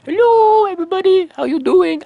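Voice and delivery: high and loud, chirpy video-call hello; loud bubbly greeting. Shown as a demonstration. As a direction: burst onto the call beaming. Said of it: "My Skype calls are like this".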